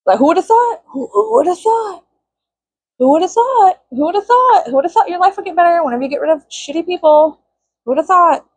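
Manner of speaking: high, sing-song sarcastic repeats; mock amazement, drawn high; sarcastic refrain. To viewers about leaving bad people, high sarcastic sing-song.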